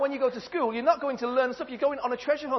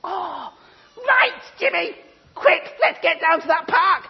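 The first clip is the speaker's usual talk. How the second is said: much higher, loud, laughing squeals; amazed mum; 7 semitones higher, 6 dB louder, slower than usual. Acted. Mum finding a diamond in Jimmy's bag, shrill and excited. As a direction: gush over the diamond with shrieks of delight.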